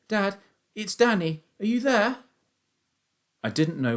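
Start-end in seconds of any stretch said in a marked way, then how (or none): 0.1-2.2 put-on high, urgent boyish call; shouting. A narrator's reading. Son calls to missing father in the wood, shouting. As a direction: call out much higher into the distance. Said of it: "I shouted".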